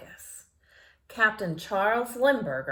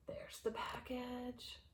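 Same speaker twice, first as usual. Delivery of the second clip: hushed aside; whisper; 3 semitones higher, 17 dB quieter than usual. Real, not acted. Reader whispering to children about the picture. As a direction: point and whisper.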